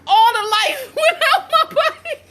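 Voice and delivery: high, breaking up with laughter; laughing hard. Real, not acted. Woman telling viewers about her scare, laughing hard. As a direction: squeal it through helpless laughter.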